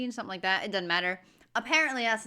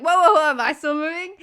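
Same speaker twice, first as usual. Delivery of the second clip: loud, raised-pitch acted panic; mock alarm; 5 semitones higher, 9 dB louder than usual. Passenger unsure if the bus still moves, mock alarm. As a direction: call out as if suddenly disoriented.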